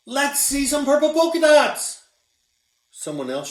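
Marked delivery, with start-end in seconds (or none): 0.1-2.0 put-on high, loud kid yelling across the room; high, loud schoolyard shout; yelled taunt. A narrator's reading. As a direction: yell it out like an excited kid. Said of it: "One yelled out".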